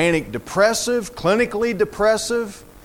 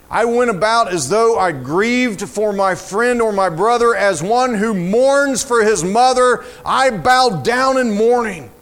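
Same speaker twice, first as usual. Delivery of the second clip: high, intense declaimed lament; dramatic psalm reading; 5 semitones higher, 5 dB louder than usual. Acted. Preacher reading of mourning for a mother, intense lament. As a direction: declaim the grief to the whole room.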